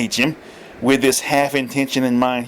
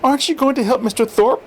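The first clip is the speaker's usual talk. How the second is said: put-on higher, insistent young woman's voice; demanded; 12 semitones higher than usual. A narrator's reading. Ramona confronting Borden about the fallen old man, insistent. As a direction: demand an answer. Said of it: "Ramona demanded".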